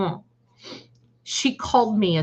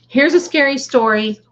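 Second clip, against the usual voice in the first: higher and louder, building hype; excited announcement; 5 semitones higher, 6 dB louder than usual. Real, not acted. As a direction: call it out to the chat. Announcing story loudly to chat.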